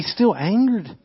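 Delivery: ordinary talk, nothing marked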